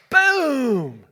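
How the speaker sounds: sudden loud, high boom that slides down; booming crash imitation. Storyteller voicing the rear-end collision, explosive boom. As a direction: burst out with the bang of a crash.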